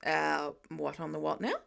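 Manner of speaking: low, deadpan confusion; lightly voiced puzzled Tony; baffled low voice. A narrator's reading.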